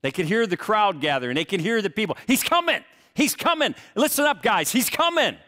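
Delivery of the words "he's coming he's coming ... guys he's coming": excited call. Retold or imitated. Lepers hear crowd announce Jesus.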